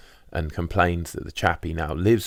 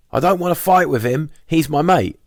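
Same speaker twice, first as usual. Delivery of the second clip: higher and louder, a sozzled yell; drunken shout; 11 semitones higher, 7 dB louder than usual. Retold or imitated. Drunk squatter shouting at his mate in a quarrel, higher and louder. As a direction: bellow it like a drunk spoiling for a fight. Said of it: "Both were shouting things like".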